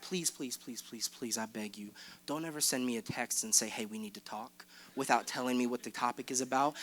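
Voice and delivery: hushed, begging aside to the crowd; soft pleading aside. Real, not acted. Youth pastor begging his listeners, hushed and pleading. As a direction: drop right down and plead softly. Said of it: "Just a little [name] fun fact".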